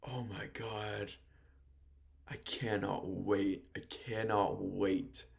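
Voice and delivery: slow, soft, low anticipation; hushed awe. Real, not acted. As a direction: savour each word, almost whispering. Showing own reaction to announced match.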